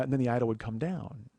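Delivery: ordinary talk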